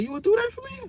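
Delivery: high falsetto imitation of a moved woman; touched Jasmine. To viewers, voicing Jasmine's touched reply in falsetto. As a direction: squeak it up, amazed and grateful. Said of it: "She was like".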